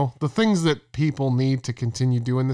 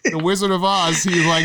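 ordinary talk, nothing marked

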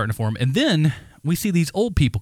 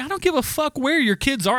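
retold higher angry retort; 12 semitones higher than usual. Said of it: "I'm like".

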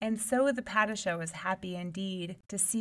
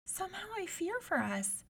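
timid fear; 12 semitones higher, 4 dB quieter than usual. A narrator's reading. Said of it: "the youngest one sighed".

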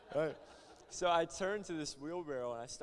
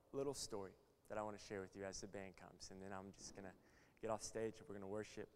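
ordinary talk

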